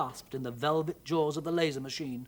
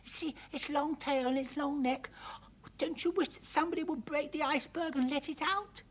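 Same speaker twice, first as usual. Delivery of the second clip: put-on high, breathy child's whisper; hushed and wishful, pitched high; 10 semitones higher than usual. A narrator's reading. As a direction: whisper eagerly like a little girl. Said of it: "whispered Arabelle".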